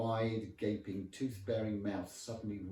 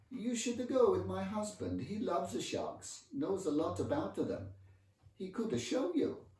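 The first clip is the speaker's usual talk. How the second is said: Italian wife; 11 semitones higher, 3 dB louder than usual. Acted. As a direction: goad sweetly in high Italian English. Italian wife voiced, goading narrator.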